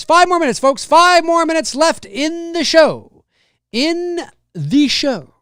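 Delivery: high, loud, slow announcer call. Acted. Streamer tells chat the time, high and loud. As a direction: stretch it out like a show host. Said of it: "announcing time left".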